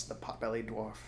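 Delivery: plain reading voice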